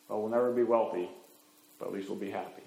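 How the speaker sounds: low, soft and gentle; the couple quietly accepting they'll just be happy; content resignation. Acted. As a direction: settle into contentment. Couple accepts their lot.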